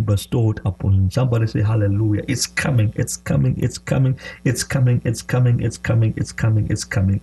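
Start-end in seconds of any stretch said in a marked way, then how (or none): none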